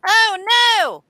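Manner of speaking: high, loud mock "oh no"; mock dismay. Host reacting to the week ahead, mock dismay. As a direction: cry out in put-on alarm.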